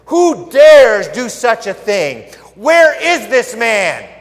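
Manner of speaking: slow, loud furious king; angry demand. Acted. King loudly demanding who threatens his queen. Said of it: "King Xerxes asked".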